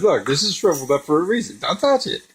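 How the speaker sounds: high-pitched emphatic warning; acted-out urgent caution; warning label voice. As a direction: warn them off, pitch jumping at the end. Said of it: "it's got a warning label built into it".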